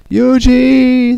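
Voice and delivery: long, high, loud wail of a name; acted desperate, drawn-out shout; anguished cry. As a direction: cry out after someone being lost. King cries out as she falls.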